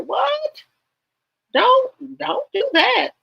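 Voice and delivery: very high-pitched, mock-incredulous squeal; incredulous reaction. Acts out reaction to critic's remark.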